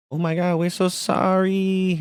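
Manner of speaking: high, slow put-on voice of a service rep; hammed-up apologetic customer service lady; caricatured rep gushing an apology.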